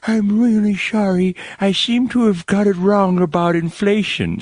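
high cartoon old granny voice; quavering little old lady apology; Janet Yellen granny voice. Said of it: "like, the old granny voice".

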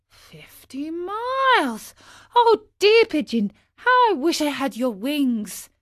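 put-on wistful high boyish exclamation; dismayed amazement. A narrator's reading. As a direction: gasp at the distance and wish aloud. Pinocchio dismayed by the distance.

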